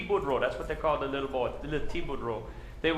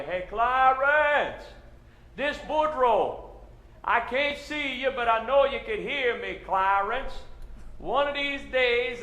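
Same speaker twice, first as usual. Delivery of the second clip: calling across the bayou; 6 semitones higher than usual. Retold or imitated. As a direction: stretch it out like yelling over a river.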